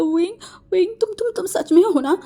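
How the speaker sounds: cling to him, terrified he's gone; frightened pleading. Acted. Waking from nightmare, clinging. (डरकर हकलाते हुए गिड़गिड़ाना)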